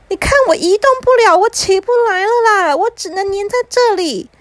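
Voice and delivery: put-on high, fast child's wail; distressed little brother. Little brother stuck to the chair appealing to mom, high and frantic. 弟弟粘在椅子上求助.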